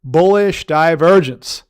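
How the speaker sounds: slow, loud chant for the class to repeat; drawn-out call-and-repeat. Shown as a demonstration. Teacher leads viewers in repeating, slow loud chant. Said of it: "say it with me".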